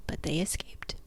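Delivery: hushed storyteller whisper; spooky campfire whisper. Acted. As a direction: breathe the ending out softly.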